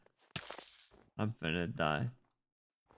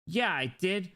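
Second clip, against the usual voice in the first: high, lively affirmation; emphatic; 7 semitones higher, 3 dB louder than usual. Pretend. Streamer playfully confirming the same thing, high and emphatic. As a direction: pitch it up, proudly emphatic. Repeats own answer, two ways.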